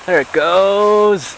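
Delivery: slow, loud, high cry of triumph; excited shout. Real, not acted. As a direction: call it out as the tree drops. Tree finally falls.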